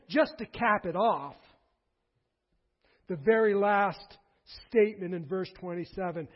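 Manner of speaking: ordinary talk, nothing marked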